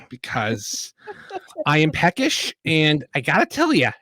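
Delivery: lightly voiced chirpy halfling; a hint of a peppy little halfling. Halfling character voice.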